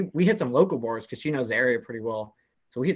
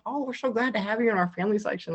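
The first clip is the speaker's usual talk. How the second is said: friendly fan voice; 8 semitones higher than usual. Retold or imitated. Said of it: "they're like".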